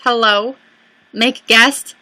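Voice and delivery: ordinary talk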